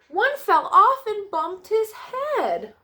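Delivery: high, slow, sing-song storybook drama; dramatic storytelling. A narrator's reading. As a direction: play up the bump for the little listeners. Dramatic fall line read to kids.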